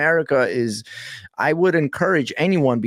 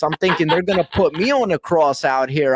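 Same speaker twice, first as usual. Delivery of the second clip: ordinary talk, nothing marked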